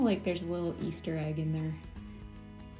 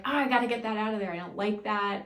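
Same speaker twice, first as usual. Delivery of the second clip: louder, quick retold objection; retold first reaction; 3 semitones higher, 6 dB louder, faster than usual. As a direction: act out your first dismayed thought, louder and faster. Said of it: "at first I was like".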